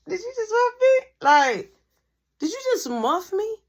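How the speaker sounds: high, loud, incredulous; shocked disbelief. Retold or imitated. Confronting the girl who groped him, high incredulous. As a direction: act out stunned outrage.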